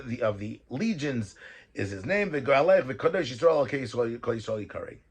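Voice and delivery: normal talking voice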